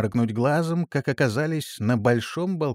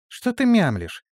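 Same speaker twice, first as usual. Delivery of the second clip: scolding gnome; 11 semitones higher, 4 dB louder than usual. Acted. Gnome scolds hesitant keeper.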